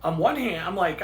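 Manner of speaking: normal talking voice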